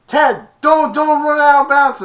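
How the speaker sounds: very high, loud urgent shout; loud yell. Retold or imitated. Teammates yelling at Tad from the sideline, high loud shout. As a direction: scream the warning at him.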